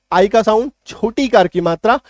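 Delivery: ordinary talk, nothing marked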